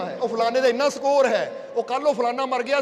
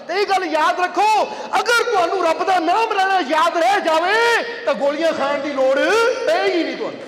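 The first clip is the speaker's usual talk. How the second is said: fervent preaching; 5 semitones higher, 6 dB louder than usual. Acted. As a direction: drive the lesson home to the congregation. Preaching at full force.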